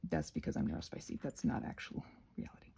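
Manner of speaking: dropped low, whispered joke; low whispered aside. Real, not acted. Crafter whispering a self-aware joke to viewers. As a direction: mutter it under your breath. Joking aside about being neurospicy.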